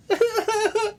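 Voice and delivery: short high burst of laughter; a quick gleeful laugh; giggling at the crying wife; high giggle. Real, not acted.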